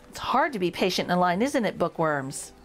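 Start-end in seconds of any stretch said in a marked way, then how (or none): none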